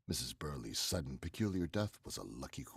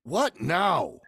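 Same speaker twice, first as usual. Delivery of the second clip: much higher, louder, alarmed; startled loud outburst; 13 dB louder than usual. Acted. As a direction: jump at her cry. Startled by her shout.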